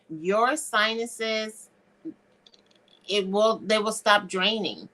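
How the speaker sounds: ordinary delivery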